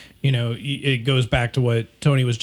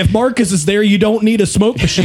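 excited; 8 semitones higher, 7 dB louder, faster than usual. Real, not acted. Joking shout-out.

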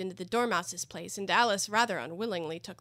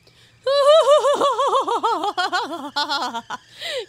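high mocking laugh; 13 semitones higher, 15 dB louder than usual. A narrator's reading. Hatter laughing.

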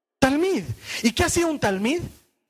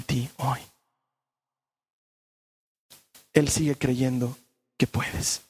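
tender sermon close; 7 semitones lower than usual. Acted. Tender closing preaching.

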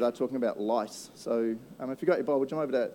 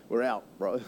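lower, laughing nervous backing out; scared magicians bailing; 5 semitones lower, 5 dB quieter than usual. Acted. Magicians quitting on Pharaoh, nervous and laughing. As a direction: back away from the fight, laughing. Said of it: "the magicians themselves are going".

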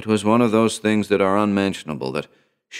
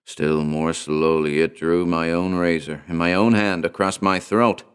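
ordinary delivery

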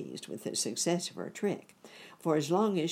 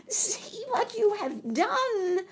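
very high, slow, loud scream; witch screaming; 5 dB louder, slower than usual. A narrator's reading. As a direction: shriek in panic as witch. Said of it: "she screamed".

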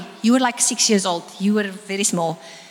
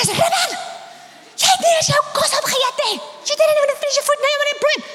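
annoyed mother scolding; faster than usual. Retold or imitated. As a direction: snap at the boy who wants bread. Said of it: "Ek is so opgewerk".

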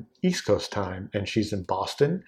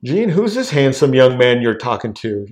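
storming in; 3 semitones higher, 7 dB louder than usual. Retold or imitated. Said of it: "opens the door and just storms in".

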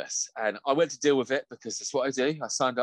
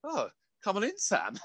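a high, mock-sweet welcome; boss welcoming; 9 semitones higher than usual. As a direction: lightly send up a too-nice boss. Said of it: "And he's like".

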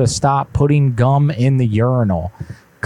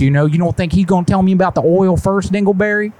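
higher, indignant put-on caller voice; prank caller voice; 7 semitones higher than usual. Prank caller to the gas station, put-on higher indignant voice. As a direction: play a fussy customer who got hung up on.

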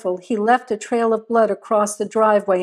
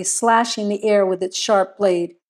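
usual speaking voice

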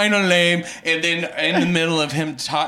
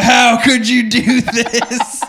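loud, slow, higher melodramatic wail; mock anguished outcry; 6 semitones higher, 7 dB louder, slower than usual. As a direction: cry out in hammed-up betrayal, laughing underneath. Hero's mock anguish after killing sister.

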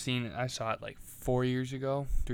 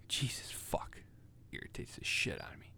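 whispered cursing; 10 dB quieter than usual. Real, not acted. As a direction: curse it under your breath. Annoyed whispered curse.

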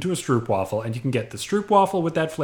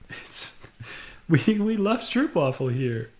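snooty waiter; 4 semitones higher than usual. Acted. Employee voice defending stroop waffle.

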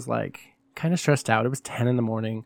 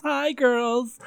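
"Hi" sung out higher like a hostess; bright diva greeting; 10 semitones higher than usual. Acted.

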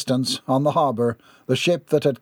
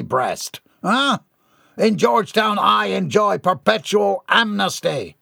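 grand, puffed-up villain speech, higher voice; a self-important tycoon addressing the crowd; pompous villain; 6 semitones higher than usual. A narrator's reading. Gerard boasting to his adoring fans, pompous.

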